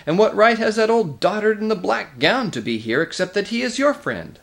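high, loud, heated question; indignant challenge. A narrator's reading. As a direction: challenge their right to be here. Nickabrick argues back at the king.

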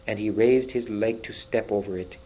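usual speaking voice

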